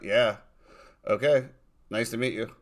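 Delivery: deadpan, understated greeting; mock indifferent politeness; imitating emotionless player. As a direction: greet them with no emotion at all. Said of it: "No emotion whatsoever. He's just like".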